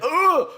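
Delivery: high sick-to-the-stomach ugh; disgusted groan. Retold or imitated. Recalling the awkward moment, high queasy groan. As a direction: groan as if your stomach dropped. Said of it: "I was like".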